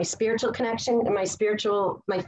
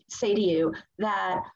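usual speaking voice

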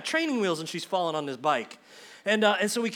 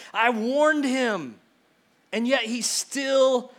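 higher, slow and drawn out, protesting; exasperated self-reproach; 4 semitones higher, 4 dB louder, much slower than usual. Acted. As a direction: stretch each word in pained disbelief. Peter's intense conversation with himself.

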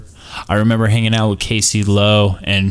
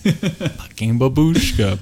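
put-on Russian accent; 5 semitones higher, slower than usual. Mock Russian babushka.

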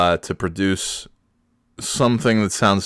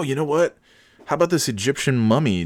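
ordinary talk, nothing marked

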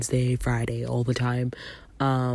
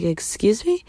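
higher, lighter repeat of excuse me; indignant excuse me; 7 semitones higher than usual. Retold or imitated. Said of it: "I looked at her and I was like".

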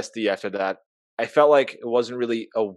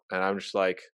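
normal talking voice

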